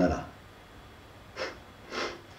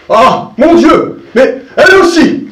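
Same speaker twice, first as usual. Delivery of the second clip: put-on much higher, louder shocked old man; stupefied cry; 11 semitones higher, 6 dB louder, slower than usual. Seguin learning his goat wants to leave, shocked loud cry. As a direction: cry out in dismay, dragging the ah. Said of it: "cria monsieur Seguin stupéfait".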